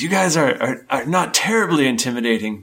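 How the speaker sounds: a captive trying to sound unafraid; nervous cultist. Acted. Captive cultist to the party, high and jittery. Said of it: "He goes".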